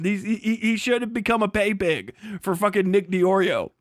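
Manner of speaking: normal talking voice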